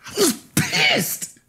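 pissed cried out high after the whisper; outburst. Acted. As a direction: let the anger finally break out. Admitting anger, repeated louder.

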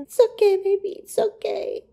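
high cooing comfort, softening at the end; tender, high-pitched pet-talk voice. Real, not acted. Soothing Oliver as baby.

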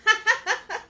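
high-pitched giggle. Real, not acted. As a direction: burst of high laughter. Laughs about spit trick.

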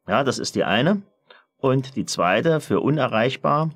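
ordinary talk

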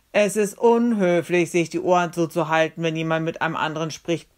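blaring rebuke, easing off toward the end. Acted. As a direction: blare the reproach into someone's ears. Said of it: "plärrte Julius um meine Ohren".

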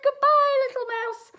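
very high, panicked farewell; frightened fox. Acted. Fox bids the mouse goodbye in acted panic. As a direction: squeal it in mock alarm.

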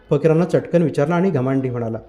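ordinary delivery

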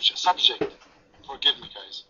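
ordinary delivery

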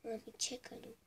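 breathy unvoiced whisper, very soft. Real, not acted. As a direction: whisper it so nobody hears. Whispers while starting the shot.

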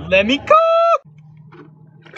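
very high, slow catchphrase; high drawn out. Pretend. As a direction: stretch it out up high. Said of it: "catchphrase sung out high and slow".

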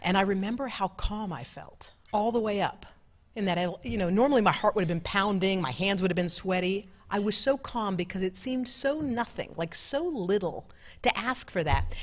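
ordinary talk, nothing marked

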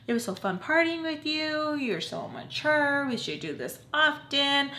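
high, gushing imitation of her friend. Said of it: "she was just telling me".

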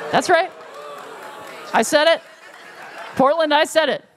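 playfully indignant insistence; mock defiance. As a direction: dare the crowd to object.

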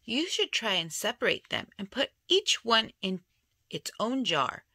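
usual speaking voice

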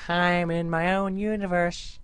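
high, dreamy spaced-out voice; dreamy character voice. Retold or imitated. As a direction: voice a character lost in thought.

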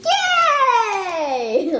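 high squealing yay; excited cheer. Real, not acted. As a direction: cheer with delight. Cheers at the consequence.

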